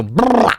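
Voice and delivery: high rolled "brrah" splutter; brain-exploding noise. Retold or imitated. Stressed student's brain overloading, high spluttered noise. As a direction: make the noise of a frazzled brain. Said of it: "making your brain go".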